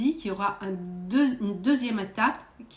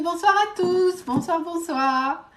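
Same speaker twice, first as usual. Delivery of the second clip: higher, louder, slow sing-song hello; drawn-out cheerful greeting; 8 semitones higher, 5 dB louder, slower than usual. Real, not acted. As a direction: welcome the viewers warmly and loud. Livestream greeting to viewers. (salut chantant, lent et fort)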